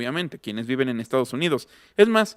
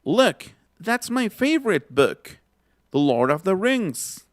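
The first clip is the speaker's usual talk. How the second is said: higher, slow, every word set out clearly; slow model phrase; 4 semitones higher, slower than usual. Shown as a demonstration. Teacher models a phrase for students, slow and high. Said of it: "Otra vez".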